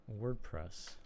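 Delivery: normal talking voice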